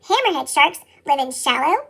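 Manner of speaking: ordinary delivery, nothing marked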